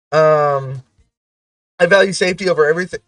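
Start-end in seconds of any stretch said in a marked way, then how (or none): 1.8-3.0 rushed read-out. Shown as a demonstration. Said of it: "I redeemed something".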